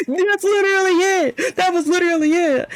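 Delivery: high-pitched incredulous whine; exasperated complaint. Real, not acted. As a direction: complain in a high, drawn-up squeal. Griping about a lousy sticker reward.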